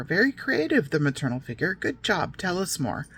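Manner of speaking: normal talking voice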